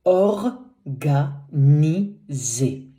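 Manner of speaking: organisé stretched out very slowly, syllable by syllable; very slow teacher model of organisé; slow French demo. Shown as a demonstration. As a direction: spell out organisé with your voice. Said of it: "Il est, he is".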